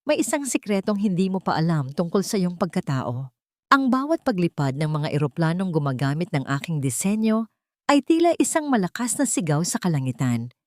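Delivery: usual speaking voice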